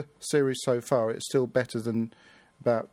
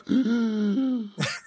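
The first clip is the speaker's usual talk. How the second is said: mock gasp; 11 semitones higher, 3 dB louder than usual. Reacts to Captain Jack moment.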